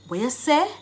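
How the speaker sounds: quick Jamaican "weh yuh seh", rising; Jamaican Patois. Shown as a demonstration. Teacher translating a question for learners, in Jamaican Patois. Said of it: "I've given you the Jamaican dialect".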